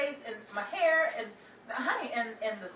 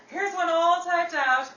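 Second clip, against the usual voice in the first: ordinary talk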